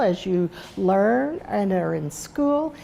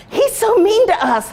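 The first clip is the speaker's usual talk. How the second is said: put-on high, loud child's whine about a brother; whiny kid complaint; 12 semitones higher, 6 dB louder than usual. Sons complaining to mom about their brother, high loud whine.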